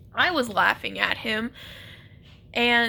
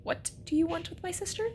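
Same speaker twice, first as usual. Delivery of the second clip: protective brother voice; 6 semitones higher, 8 dB quieter than usual. Retold or imitated. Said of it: "my brother's just like".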